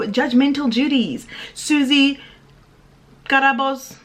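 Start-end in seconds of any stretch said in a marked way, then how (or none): none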